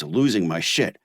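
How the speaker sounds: ordinary delivery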